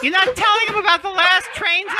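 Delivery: high shrieking yell; Kathy Griffin shout. Retold or imitated. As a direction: shriek the line out. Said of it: "Kathy Griffin voice".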